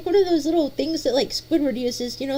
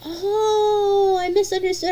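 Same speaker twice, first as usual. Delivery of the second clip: mock crying; 6 semitones higher, slower than usual. Realizes she misunderstood, dramatic.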